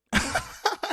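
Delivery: high, loud burst of laughter; real laugh. Real, not acted. Storyteller cracking up at her own admission, laughing. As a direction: crack up loudly.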